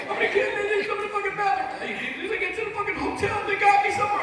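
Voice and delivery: high, loud, laughing panic voice; panicked friend on phone. Retold or imitated. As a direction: squeal like a drunk buddy begging for rescue. Rita's voice continues on phone.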